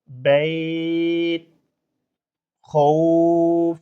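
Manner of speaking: each word very slow, held on its final consonant; pausal stops. Shown as a demonstration. Teacher reviews the waqf readings of all the words slowly. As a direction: stretch every word and stop without a final vowel. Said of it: "আমরা সেইভাবে পড়ব".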